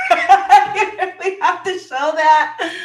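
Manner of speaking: laughing out the words, high and loud. Real, not acted. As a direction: say it through a big laugh. Laughing while urging her.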